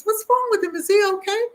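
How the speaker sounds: high, fretting woman's voice; a worried lady checking on the child; woman behind her in the store asking about the crying boy, high worried voice; concerned stranger. Retold or imitated. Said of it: "this woman behind me says".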